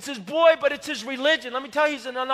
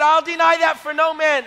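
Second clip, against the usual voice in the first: loud, defiant declaration; loud defiant preaching; preaching boldly; 5 semitones higher, 8 dB louder than usual. Retold or imitated. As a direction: proclaim it like a preacher at full force. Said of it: "I'm talking like this".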